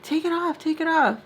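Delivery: high-pitched, insistent mimicry; high mock pestering; imitated Munchkin pleading. Voicing Munchkin pulling on her.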